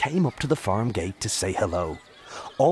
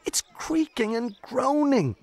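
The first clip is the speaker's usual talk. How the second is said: disbelief. A narrator's reading. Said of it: "said Jake with disbelief".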